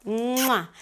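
smacking kiss into the mic; air kiss. Real, not acted. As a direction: blow a kiss. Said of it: "That's a kiss".

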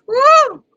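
high whooping cheer; hyped-up whoop at the joke; loud whoop. Real, not acted. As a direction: let out a big excited woo. Hyped reaction.

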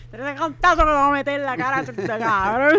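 loud mock movie-line shout, dragged ending; grito imaginado. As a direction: belt out the imagined line, stretching the end. (grito exagerado de frase de película)

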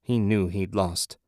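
ordinary delivery, nothing marked

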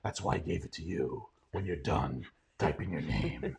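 very low, soft, solemn elder; Morgan Freeman. Acted. Tech chief handing back too much power, low and solemn. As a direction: sink into quiet, measured gravity. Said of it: "a bit where I do Morgan Freeman".

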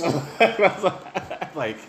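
words squeezed out through laughter; laughing. Real, not acted. Retelling the bag of nuts while laughing.

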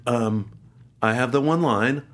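usual speaking voice

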